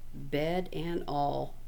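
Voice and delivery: plain reading voice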